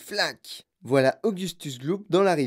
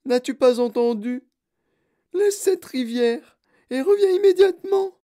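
very high, loud order; high-pitched, exasperated mother shouting; mother's scolding cry; 11 semitones higher, 7 dB louder than usual. A narrator's reading. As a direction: cry out a command to a disobedient child. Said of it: "s'écria madame Gloop".

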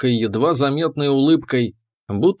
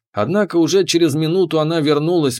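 ordinary delivery, nothing marked

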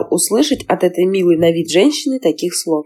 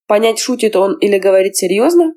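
plain reading voice

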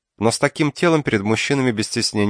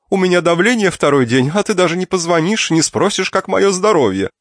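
complaining aunt; 11 semitones higher, 5 dB louder than usual. Acted. Aunt complains of blood pressure.

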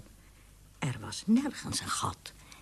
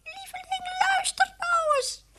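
very high, pleading mouse voice; squeaky mouse. A narrator's reading. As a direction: squeak pleadingly to your wife. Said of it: "was een eng gepiep".

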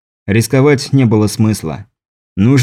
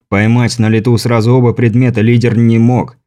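ordinary delivery, nothing marked

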